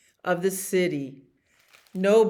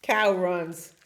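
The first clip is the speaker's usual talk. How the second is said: usual speaking voice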